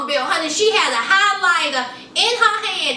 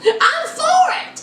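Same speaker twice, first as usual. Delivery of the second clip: much higher, eager mock voice of Maria; eager girlfriend; 11 semitones higher, slower than usual. Retelling the side girlfriend agreeing, in a high eager voice. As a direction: chirp it up high like she's all in. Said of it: "She was like".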